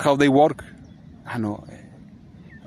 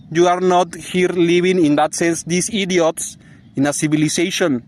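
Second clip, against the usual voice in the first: ordinary delivery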